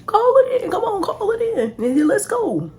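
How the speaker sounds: high mock dad pushing her to call it in; imitating dad. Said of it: "that's when he was like".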